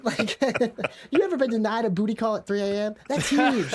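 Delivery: high laughing voice. Real, not acted. Joking to friends about a denied booty call, laughing high.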